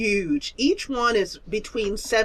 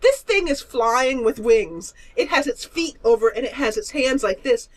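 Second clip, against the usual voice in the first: usual speaking voice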